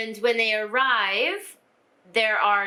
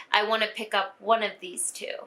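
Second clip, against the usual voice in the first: ordinary talk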